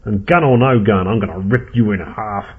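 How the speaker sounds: loud, defiant vow of revenge; heated, forceful threat; angry threat. Acted. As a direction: start loud and threaten hard. Ed threatens his wife's killer.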